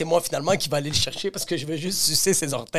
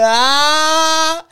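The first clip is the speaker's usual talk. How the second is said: high, long, loud "ah"; loud mock laugh-cry; 10 semitones higher, 7 dB louder than usual. Imitating the educator after her sentence, loud mock outburst.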